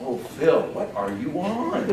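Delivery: low, hushed mock disbelief; freaked-out friend. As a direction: draw it out as a baffled friend. Friend reacts to the frantic pitch.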